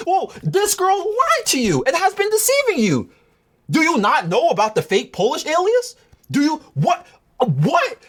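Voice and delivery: high hammed-up astonishment; mock disbelief. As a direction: act floored by being called manipulative. Arguing back in disbelief.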